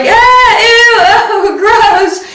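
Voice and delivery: high loud grossed-out squeal; disgusted squeal. Retold or imitated. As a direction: squeal with disgust, half laughing. Grossed out pulling the ant.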